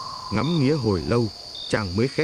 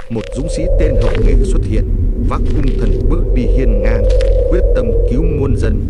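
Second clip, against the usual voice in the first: heroic narration; 8 dB louder than usual. A narrator's reading. Hero enters, epic narration.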